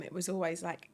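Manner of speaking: ordinary delivery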